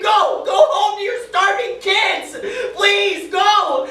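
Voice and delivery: begging him to go home, screaming; desperate scream. Retold or imitated. Soldier screaming at prisoner to go home, pleading scream. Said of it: "I scream".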